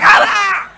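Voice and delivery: high pained yelp; kid's squashed cry. Retold or imitated. Kid under his dad's calves crying out high. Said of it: "So he's like".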